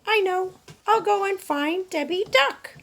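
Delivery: high, bright little hen voice; Dora the hen. Acted. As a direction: play a chirpy young hen wondering aloud.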